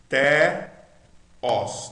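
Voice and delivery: "Teos" as té-ós, both vowels wide open; loud, drawn-out "Teos" with open E and O; all vowels open. Shown as a demonstration. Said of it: "tudo aberto".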